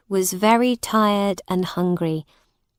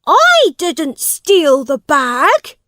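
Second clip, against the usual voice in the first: loud, high and slow protest; defiant denial; 8 semitones higher, 7 dB louder, slower than usual. Acted. Accused boy to the crowd, loud slow protest. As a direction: insist on it, each word hammered.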